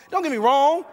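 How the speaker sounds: ordinary talk, nothing marked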